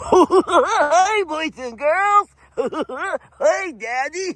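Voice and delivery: very high, laughing purple-dinosaur voice; Barney voice. Pretend. Voicing Roman the dog, very high Barney-like kids' show voice. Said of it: "it always comes out like Barney".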